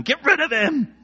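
high-pitched mob shout. A narrator's reading. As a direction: shout it high like an angry crowd. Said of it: "kept shouting".

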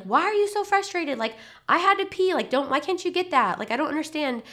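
high-pitched exasperated parent, acted out; mock exasperation. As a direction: whine in frustration at a child, hammed-up. Mock frustrated parent, the wrong approach.

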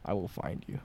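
low, soft menacing; creepy quiet threat; sinister low threat. Pretend.

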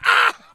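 very high, loud pained scream; shock scream. Real, not acted. Man shocked by bug zapper, high scream. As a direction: scream out from the jolt.

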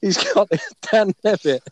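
normal talking voice

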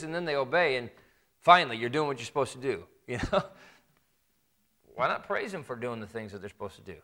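ordinary delivery

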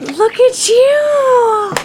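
high, slow and amazed; drawn-out proud gush. Real, not acted. Friend gushing over news.

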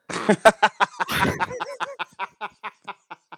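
long, high, helpless laughing fit; real laughter. Real, not acted. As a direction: lose it completely laughing. Cracks up at fat joke.

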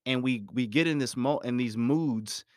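ordinary talk

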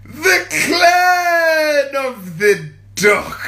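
slow, loud, high cry-shout; wailing shout. Pretend. Crying out the catchphrase to her followers. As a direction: wail it like a mock lament.

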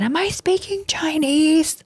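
very high, slow exasperated mom voice; grouchy mom. Retold or imitated. Grouchy mom to kids not listening, very high and slow. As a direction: drag it out in mock exasperation. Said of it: "I said".

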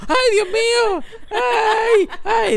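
hammed-up horror-movie panic; mock panic. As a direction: yelp each "ay" in fright.

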